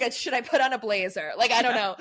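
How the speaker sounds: laughing fluster. Retold or imitated. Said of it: "I'm like".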